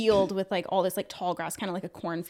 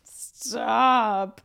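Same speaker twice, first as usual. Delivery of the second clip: long drawn-out, louder mock exasperation; exasperated plea; 5 dB louder than usual. Viewer reacting to an actress's every line, drawn-out exasperation. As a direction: stretch the word into a pained plea.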